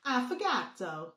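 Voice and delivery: hammed-up Italian accent on Affogato. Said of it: "is that your Italian accent".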